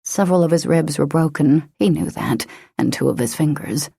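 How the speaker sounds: usual speaking voice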